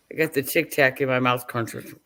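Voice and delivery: normal talking voice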